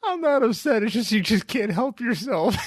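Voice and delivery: high-pitched squeal breaking into laughter; mock protest. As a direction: argue back in a silly high voice. Mock squabble in high voices.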